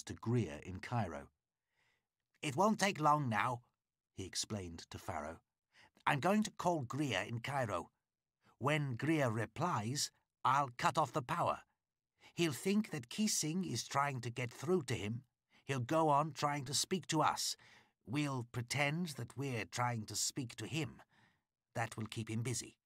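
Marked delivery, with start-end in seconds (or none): 2.4-3.6 a lightly voiced driver, slightly raised; a hint of a busy radio man, brisk; lightly voiced driver, a little higher and louder. A narrator's reading. Driver speaking in the story. 6.1-22.8 a lightly voiced driver, slightly raised; a hint of a busy radio man, brisk. A narrator's reading. Driver explains his radio trick.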